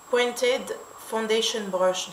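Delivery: "Pointed Foundation Brush" slowly, in a French speaker's attempt at an English accent; careful English accent. Shown as a demonstration. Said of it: "excusez mon anglais aussi". (nom anglais prononcé lentement, avec un accent anglais appliqué)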